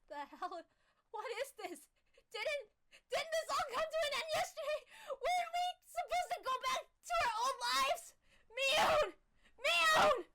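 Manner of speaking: high, disbelieving, rising to a yell; shocked shout. Acted. Keiichi calling out to crying Mion, high shocked shout. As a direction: cry out in confused disbelief.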